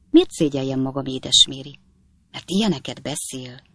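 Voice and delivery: plain reading voice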